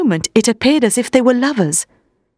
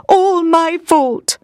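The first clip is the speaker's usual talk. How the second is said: moaning; 8 semitones higher, much slower than usual. Acted. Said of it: "he moaned".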